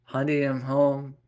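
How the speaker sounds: calling out. Acted. Husband announcing he is home to his wife, calling out. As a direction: call through the house.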